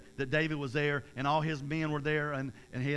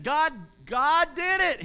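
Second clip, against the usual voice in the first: much higher, loud triumphant cry; exclaimed; 11 semitones higher, 11 dB louder, much slower than usual. A narrator's reading. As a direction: shout the credit to God with joy. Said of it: "he exclaimed".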